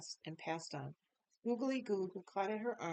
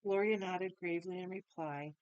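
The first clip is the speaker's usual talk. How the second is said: usual speaking voice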